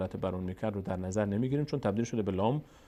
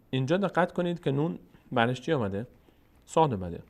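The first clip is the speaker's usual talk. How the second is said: usual speaking voice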